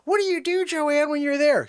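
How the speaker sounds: high-pitched imitation of puzzled people; mock questioning. Everybody asks Joanne what she does, mock high voice.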